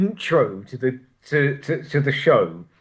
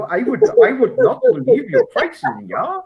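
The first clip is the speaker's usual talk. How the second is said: higher mock German accent; imitating a German accent; 11 semitones higher than usual. Teasing Dennis about his German impression, mock accent. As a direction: put on a jokey German accent. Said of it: "This is how Dennis thinks you talk".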